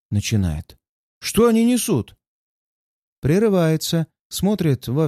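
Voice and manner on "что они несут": pitched up in shock; stunned, can't believe it; startled disbelief. Acted. Пилот возмущён, не понимает. (ошарашенное недоумение)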